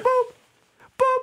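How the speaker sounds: high, evenly spaced "boop"; very high electronic-style boop; imitated monitor beep.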